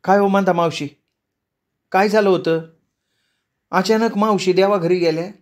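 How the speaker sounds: a hint of a nosy neighbor asking; neighbor women. Neighbor woman asking the widow, lightly voiced. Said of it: "त्या म्हणाल्या".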